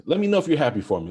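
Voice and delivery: fast, high and loud, bursting with eagerness; excited plea. Real, not acted. Host begging his viewers to share his joy, fast and excited. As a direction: rush it out like you can't wait.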